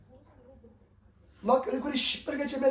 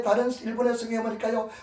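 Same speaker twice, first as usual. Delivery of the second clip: usual speaking voice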